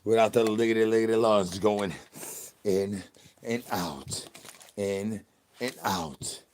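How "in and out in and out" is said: slow, soft guided breath count; mock calm breathing instruction; slow breathing cue. Shown as a demonstration. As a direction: draw it out like a breathing coach. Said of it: "Say it with me".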